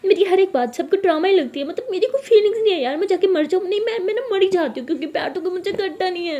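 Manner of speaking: overacting tearful tantrum to mom; over-acted crying. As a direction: wail it like a soap heroine. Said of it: "ये over acting मेरे सामने".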